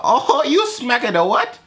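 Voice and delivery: very high mock broken-English woman; imitated broken-English woman, startled and high; broken English accent. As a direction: squeal it in put-on broken English. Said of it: "I like broken English".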